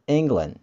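England, set against English; contrast. Shown as a demonstration. Teacher corrects English to England. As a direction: stress England as the correct word. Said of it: "itu bukan English".

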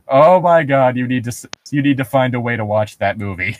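ordinary delivery

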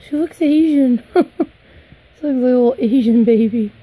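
loud, delighted outburst with a laugh; excited exclamation. Real, not acted. Collector reacting to her doll's looks, excited exclamation. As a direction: exclaim it loudly, thrilled.

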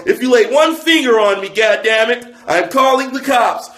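high-pitched, hammed-up outrage; mock indignant threat. Comic voices the shoe wearer threatening police, mock indignant.

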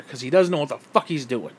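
usual speaking voice

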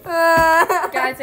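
laughing. Real, not acted. Laughing crying at sour.

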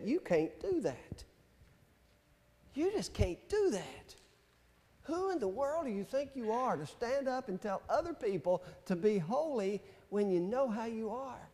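a quiet doubting inner voice, lightly voiced. Preacher voicing his inner doubt, quietly accusing. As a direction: murmur it as the voice of self-doubt. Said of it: "something in me said".

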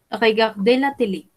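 ordinary delivery

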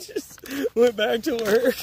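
high, loud, through laughter; laughing. Real, not acted. Driver recounting a pointless chase, laughing as he talks. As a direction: crack up while telling it.

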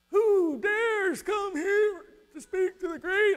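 high, slow, thundering shout of a wizard; menacing wizard bellow, high and slow; booming wizard. Acted. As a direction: roar it out like the great and terrible Oz. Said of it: "I tried to say that in a nicer way".

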